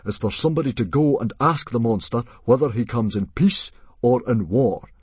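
loud, commanding chief voice; forced confidence. Acted. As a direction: bluff your way into sounding in control. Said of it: "trying to sound as if he was on top".

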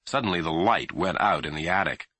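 ordinary delivery

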